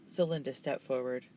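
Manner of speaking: ordinary delivery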